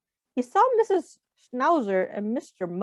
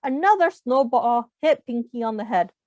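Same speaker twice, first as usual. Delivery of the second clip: ordinary delivery, nothing marked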